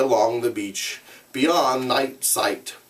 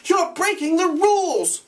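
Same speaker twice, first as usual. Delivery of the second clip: shout. Acted. Ralph shouting at Jack about the rules. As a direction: shout it out against the noise. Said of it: "shouted Ralph".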